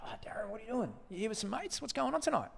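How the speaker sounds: high, soft and breathy, almost whispered; gentle, high, friendly. Retold or imitated. Chatting up the heckler Darren, high and soft. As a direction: coax Darren kindly, light and high. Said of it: "just gently".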